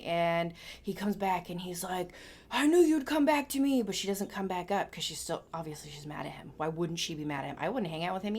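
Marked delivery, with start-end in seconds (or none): 2.5-3.8 high, loud gloating call; old man's triumphant cry. Retold or imitated. Old man calling down to the fountain, high loud gloat. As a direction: crow it out as a smug old man.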